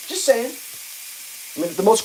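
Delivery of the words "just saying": "Just saying" again, pitched higher and pointed; a higher, sassier "Just saying"; sarcastic repeat. Shown as a demonstration. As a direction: tack it on with a sarcastic lilt.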